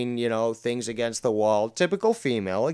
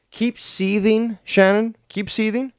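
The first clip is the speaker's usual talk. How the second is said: taunting; 9 semitones higher, 5 dB louder than usual. Real, not acted. Taunts the angry caller.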